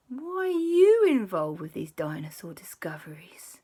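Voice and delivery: whispered probing question; suspicious whisper. Acted. Auntie Lisa questioning JB, whispered suspicion.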